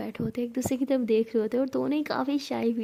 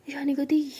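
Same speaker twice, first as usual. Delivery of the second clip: plain reading voice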